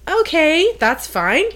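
high, tentatively agreeable voice; putting on acceptance. Retold or imitated. Academic woman faced with sketchy hotel, putting on acceptance. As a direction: act out making the best of it.

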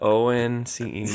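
O N C E spelled letter by letter; spelling it out. Shown as a demonstration. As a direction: spell O N C E one letter at a time. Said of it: "Would you like me to spell it for you?".

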